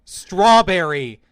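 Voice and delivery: imitated yelling. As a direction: imitate a frustrated yell at a microphone. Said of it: "increasingly yelling in volume".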